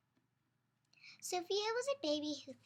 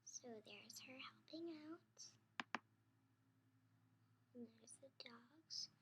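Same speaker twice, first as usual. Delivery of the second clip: hushed whisper pointing at the page; breathy-free soft whisper aside; 2 semitones lower, 21 dB quieter than usual. Real, not acted. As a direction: whisper it to the little listener. Whispered aside about picture.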